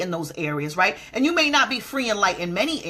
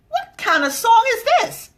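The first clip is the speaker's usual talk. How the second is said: high, loud disbelieving squeal; incredulous; 13 semitones higher, 6 dB louder than usual. Retold or imitated. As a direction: react in shocked disbelief at the song. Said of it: "I said".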